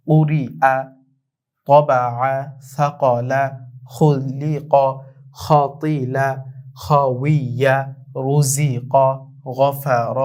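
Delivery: measured Arabic words, each set apart, heavy letters full; thick-letter recitation drill. Shown as a demonstration. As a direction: read word by word for learners to repeat. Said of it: "আমি পড়ছি". (মোটা হরফ ভারী করে থেমে থেমে পড়া)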